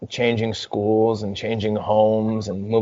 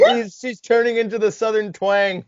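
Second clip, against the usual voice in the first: spoken through real laughter, pitched high; cracking up while talking; the friend laughing at the mom's new twang; 12 semitones higher than usual. Real, not acted. Laughing comment on the story.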